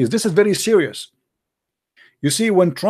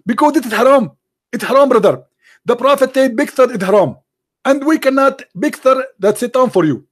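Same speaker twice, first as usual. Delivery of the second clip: higher, louder, confident preacher; 6 semitones higher, 3 dB louder than usual. Pretend. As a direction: play a smug, certain preacher.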